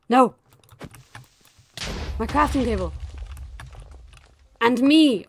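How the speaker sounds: loud gasp and cry of dismay; shocked cry. Real, not acted. Gamer cries out as the crafting table burns.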